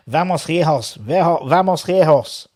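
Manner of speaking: rally them in put-on Spanish; practising Spanish. Shown as a demonstration. Fan practising Spanish before the match, put-on Spanish accent. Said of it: "we can all practice our Spanish".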